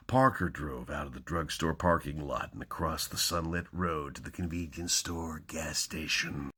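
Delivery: dramatic hardboiled crime reading; hammy stage actor. Acted. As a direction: punch each opening, then hush it. Reads crime novel openings dramatically.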